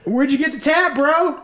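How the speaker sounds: put-on belligerent drunk. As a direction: call him out like a rowdy drunk. Confronting Thad, high pitched.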